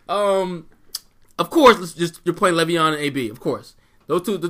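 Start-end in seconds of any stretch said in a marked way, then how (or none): none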